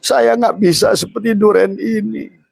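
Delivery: high, pleading cry to God; tearful prayer. Acted. Eater of durian prays to God in a high tearful plea. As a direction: plead like someone near tears. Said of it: "harusnya nangis".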